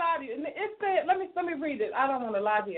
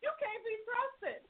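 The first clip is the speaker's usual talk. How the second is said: heated outburst; 8 semitones higher than usual. Real, not acted. Host scolding the caller who slept with her sister's husband, voice breaking. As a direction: let her have it, voice cracking.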